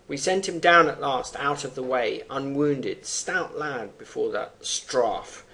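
measured verse recitation; 朗诵诗歌; poem reading. Acted. Reads poem stanza.

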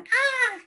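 short high rat shriek; imitated rat scream. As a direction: mimic a rat's high-pitched cry. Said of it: "literalmente gritan".

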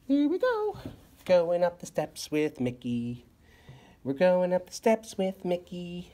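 high, sing-song toy mouse voice; playful Mickey voice. Pretend. Parent voices Mickey toy for toddler.

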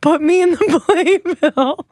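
ordinary talk

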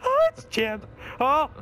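very high, loud panicked cry. Real, not acted. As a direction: yelp in real panic. Door jammed while chased.

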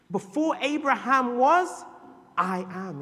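high, loud and slow; preaching. Acted. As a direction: declare it grandly. Preaching proclamation.

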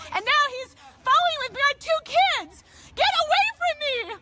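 high, loud, tearful pleading; frightened mother begging to be left alone; crying distress. Real, not acted. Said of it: "she's crying".

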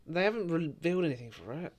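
ordinary talk, nothing marked

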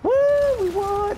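high, loud victory whoop; triumphant cheer. Real, not acted. Player celebrating that we won, triumphant cheer.

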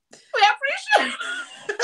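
high, slow, breaking into laughter; giggling while trying the phrase; laughing through words. Real, not acted. Laughing nervously while greeting viewers in Pidgin.

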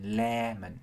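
"lemon" with LE drawn out; LEEE-mon, stress up front in "lemon"; stretched first syllable. Shown as a demonstration. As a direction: hold the first syllable of "lemon" a little longer. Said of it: "kéo dài một chút".

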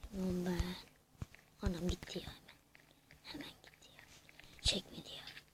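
hushed whisper while sneaking. Real, not acted. Gamer sneaking up on enemies, whispering. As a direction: keep it to a breath so nobody hears.

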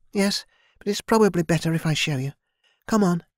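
put-on a friendly young maid explaining; young servant girl Kira. A narrator's reading. Narrator voices Kira.

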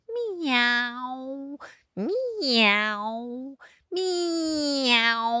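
long, high miaow; cat meow. Acted. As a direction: wail it like a cat. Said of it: "with that excellent meow".

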